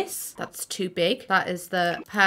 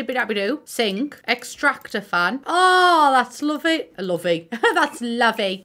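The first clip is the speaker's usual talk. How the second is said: normal talking voice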